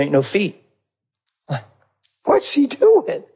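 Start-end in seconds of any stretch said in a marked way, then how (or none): 2.2-3.2 high and slow, baffled; puzzled. Retold or imitated. Watching the coworker check stalls, slowly baffled. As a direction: squint at the strange behavior.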